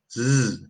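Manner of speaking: a long buzzing "zzz"; buzzing z sound. Shown as a demonstration. Teacher showing the English z, long buzzing zzz. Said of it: "this is the sound of the z".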